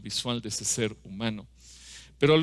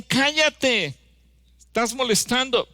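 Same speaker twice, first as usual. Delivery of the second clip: high, loud angry shout; shouting hush him; 11 semitones higher, 6 dB louder, slower than usual. Retold or imitated. Disciples shouting to silence the beggar, loud high anger. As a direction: bark at them to silence him. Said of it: "le decían".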